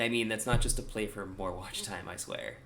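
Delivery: ordinary delivery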